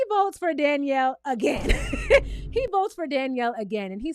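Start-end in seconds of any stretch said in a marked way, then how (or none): 1.7-2.5 sudden high squeal of a laugh; high burst of laughter. Real, not acted. A host reacting to a shocking vote with a high laugh. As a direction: let a shrill laugh burst out.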